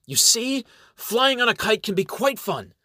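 high, chipper voice of a plucky heroine; plucky good humor. A narrator's reading. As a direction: crack the joke brightly, pitched well up. Plucky heroine jokes after a fall.

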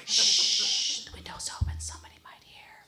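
shushing whisper; hushing whisper. Retold or imitated. Wife hushing her husband about the open window, in a whisper.

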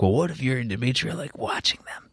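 hushed conspiratorial whisper. Retold or imitated. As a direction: whisper it like a secret plot.

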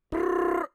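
loud, high buzzing brrr; startled mock buzz; buzzing brain noise. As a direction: make your brain short-circuit with a rattling brrr. Brain glitching at the crack.

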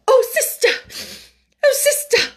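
high, loud wail; sniffling, distressed high voice; tearful cry. A narrator's reading. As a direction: wail it as if in tears. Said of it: "wring her fat hands and cry".

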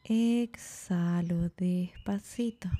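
words stretched out slowly, trailing into breath; slow exhale cue. Shown as a demonstration. Teacher guiding a slow breath out, drawn-out delivery. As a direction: draw it out to pace the breath.

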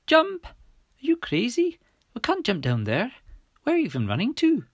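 high-pitched incredulous shout. A narrator's reading. Millie balking at jumping from the roof, high shout. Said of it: "Millie shouted".

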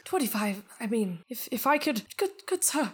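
grovel politely to the spirit; fawning miser. Acted.

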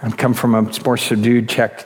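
normal talking voice

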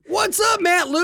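high, loud shout-out; hype greeting. Real, not acted. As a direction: greet him like a hype host.

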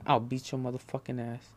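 ordinary delivery

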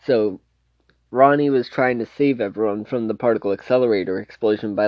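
usual speaking voice

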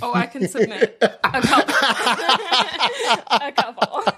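laughing aside. Real, not acted. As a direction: joke while laughing. Joking aside.

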